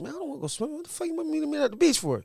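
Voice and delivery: put-on high-pitched complaining young guy; whiny dude. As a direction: protest the early beach trip.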